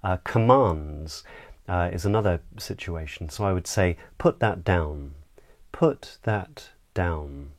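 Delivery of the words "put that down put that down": slow command, "down" dropping low; downward inflection. Shown as a demonstration. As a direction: order it slowly, falling onto "down". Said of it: "downward inflection".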